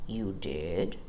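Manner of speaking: low, slow bear voice; deep bear voice. A narrator's reading. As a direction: rumble it like a big old bear. Bear's voice in story.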